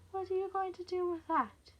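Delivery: high, mocking question; teasing. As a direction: taunt her as if amused. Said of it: "teasing now, playing with her".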